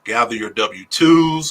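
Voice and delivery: ordinary talk, nothing marked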